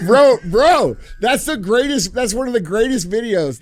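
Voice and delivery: high, thrilled praise; excited. Real, not acted. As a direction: gush about the video. Hyped about a favorite video.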